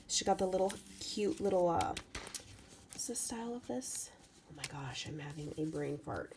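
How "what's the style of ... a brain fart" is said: a soft whispered question to herself; whispered aside. Real, not acted. Whispering to herself while hunting for the name of a style. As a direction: whisper it to yourself, searching for a word. Searching for a word, half to herself.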